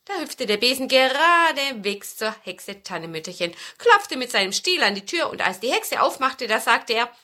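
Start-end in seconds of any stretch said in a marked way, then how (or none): none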